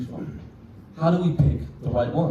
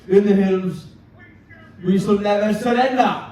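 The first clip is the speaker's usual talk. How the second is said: Winston Churchill; 3 semitones higher than usual. Acted. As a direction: deliver a rallying war speech phrase by phrase. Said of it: "Winston Churchill's famous speech".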